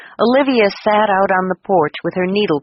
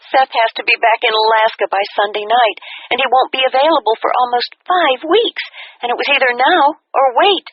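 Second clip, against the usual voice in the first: put-on excited young daughter; 6 semitones higher than usual. A narrator's reading. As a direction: rush it out like the breathless bride. Daughter voiced on phone.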